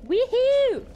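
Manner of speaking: high, loud "weehoo"; excited whoop. Real, not acted. Streamer hyping herself up to rock, excited whoop. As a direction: let out a gleeful high whoop.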